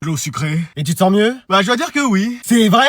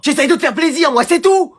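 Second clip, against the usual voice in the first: raised voice; 7 semitones higher than usual. Acted. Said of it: "Attends, ne crie pas trop".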